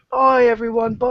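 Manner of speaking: high singsong sign-off; mock farewell chorus. Host repeating goodbye to viewers, mock high farewell. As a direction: pitch the goodbye up comically.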